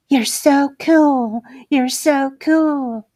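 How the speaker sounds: drawn out like a club siren; sultry purr. Acted. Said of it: "said to him words that seemed to intertwine with the music".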